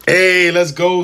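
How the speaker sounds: hyped cheer, high and loud; excited. Real, not acted. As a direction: celebrate it like a win. Celebrating a new sub on stream.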